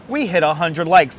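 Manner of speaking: ordinary talk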